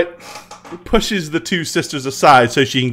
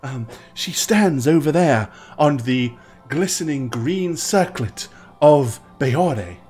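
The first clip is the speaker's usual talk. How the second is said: put-on a nervous young temple sister; young priestess voice; 4 semitones lower than usual.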